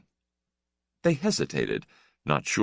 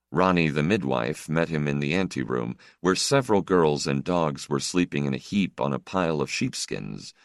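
plain reading voice

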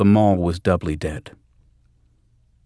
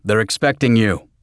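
raised voice over roaring engine; shouting over engine; 3 semitones higher, 4 dB louder than usual. Acted. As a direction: call it out over the engine noise. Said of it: "Conroy said over the engine".

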